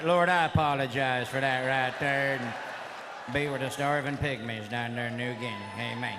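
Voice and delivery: low-pitched, solemn mock prayer; comically pious, dropping to a low amen. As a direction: drop low and pray a jokey apology. Mock prayer asking forgiveness for joke.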